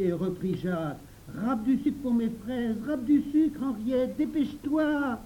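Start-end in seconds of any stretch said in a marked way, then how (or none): 1.4-5.2 put-on high, insistent child's order; insistent demand. Jacques presses Henriette to grate sugar, insistently. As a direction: nag her to hurry, pitched high. Child bosses another to hurry.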